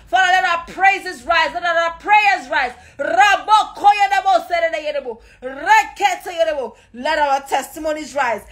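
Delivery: high, fervent prayer at full force; impassioned Pentecostal prayer, pitch held high throughout. Real, not acted. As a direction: cry out to heaven in urgent, driving declarations. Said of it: "Let us begin to raise our voice to heaven".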